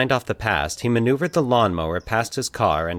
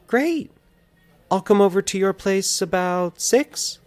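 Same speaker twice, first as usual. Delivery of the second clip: female voice; 11 semitones higher, slower than usual. A narrator's reading. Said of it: "Valerie suggested".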